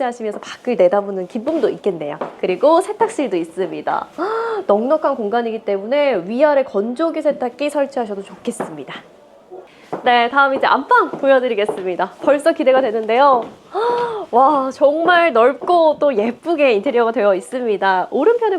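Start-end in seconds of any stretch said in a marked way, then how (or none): 4.1-4.6 sharp high gasp of amazement; impressed gasp. Acted. Gasps at laundry room. 13.7-14.2 sharp high gasp of amazement; impressed gasp. Acted. Gasps at master bedroom.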